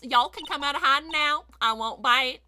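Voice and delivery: loud Southern call, then a dropped punchline; generic Southern accent. Acted. Photographer calling two people from hiding, in a Southern accent. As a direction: coax them out in a country twang. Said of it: "doing a very generic Southern accent".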